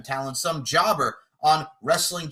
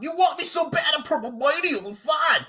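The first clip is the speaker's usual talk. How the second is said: mocking shout; 9 semitones higher than usual. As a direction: throw his words back, yelling. Quoting opponent mockingly, shouting.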